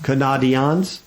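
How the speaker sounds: "Canadians" as ka-NAH-dee-ahns, questioning; mock mispronunciation. Shown as a demonstration. Host asking co-host, "Canadians" mispronounced ka-NAH-dee-ahns. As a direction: ask "Canadians" with broad ah for the ay. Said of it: "Is that how you pronounce it?".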